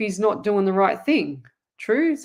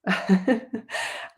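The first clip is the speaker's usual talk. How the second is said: embarrassed laugh. Real, not acted. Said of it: "Oh my God".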